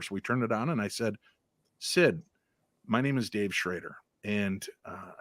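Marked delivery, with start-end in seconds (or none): 1.8-5.1 softer, gentle and respectful; calming voice. Retold or imitated. Investigator politely greeting the ghost Sid, soft calming voice. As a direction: soothe the spirit like a polite houseguest. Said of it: "talk in that calming voice".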